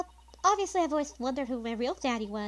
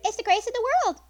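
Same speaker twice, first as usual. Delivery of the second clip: excited; 13 semitones higher, 11 dB louder than usual. Acted. Iris declares love for daddy.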